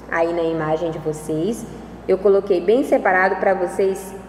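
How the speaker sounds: normal talking voice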